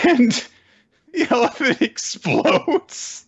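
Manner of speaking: high, squeaky words through laughter; laughing. Real, not acted. Telling a friend how the elephant explodes, cracking up. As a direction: barely get it out for laughing, slowly.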